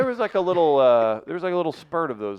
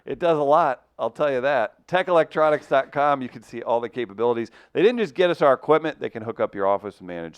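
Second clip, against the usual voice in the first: louder, upbeat sponsor pitch; ad read; 4 semitones higher, 8 dB louder than usual. Acted. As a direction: sell it like a radio ad.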